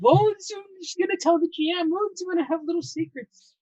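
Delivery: high, loud, sing-song mockery; mock your past self in a squeaky voice; a high teasing impression of a secretive player; mocking younger self.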